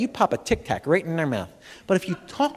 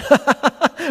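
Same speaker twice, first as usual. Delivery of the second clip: random laughter; 8 semitones higher than usual. Shown as a demonstration. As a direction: burst into laughter for no reason. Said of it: "start breaking out in laughter".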